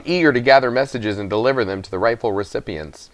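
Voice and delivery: plain reading voice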